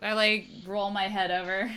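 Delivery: ordinary delivery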